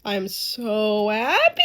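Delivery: loud, drawn out, rising at the end; overjoyed. Real, not acted. Viewer relieved she sees a therapist, overjoyed. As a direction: stretch it into a happy wail.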